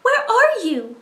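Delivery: high, thin little squeak calling for mother; a lost baby bat's squeak; frightened bat pup squeaking for its mother; squeaky baby bat. Acted. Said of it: "Stella Luna squeaked".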